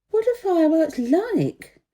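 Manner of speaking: high, slow questioning princess; little princess asking. Acted. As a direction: ask it like a wondering young princess. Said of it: "she had asked the prince".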